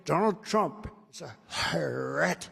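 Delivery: slow, drawn-out mocking impression; Joe Biden. Mocking his opponent's stock line to the crowd, exaggerated impression. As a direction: mimic a rival, dragging out "threat". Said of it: "He always says".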